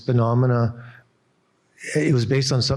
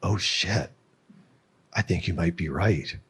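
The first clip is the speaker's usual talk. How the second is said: quiet admission; 4 semitones lower, 5 dB quieter than usual. Retold or imitated. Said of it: "she's like".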